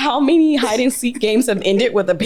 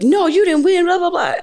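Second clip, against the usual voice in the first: mock sore loser; 10 semitones higher, 4 dB louder than usual. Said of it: "like, like".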